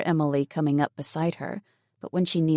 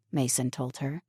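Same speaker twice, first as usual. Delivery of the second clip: plain reading voice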